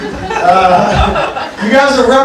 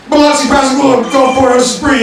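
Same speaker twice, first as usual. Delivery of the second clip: put-on drunk shouting; 6 semitones higher, 4 dB louder than usual. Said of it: "this is what he comes out with".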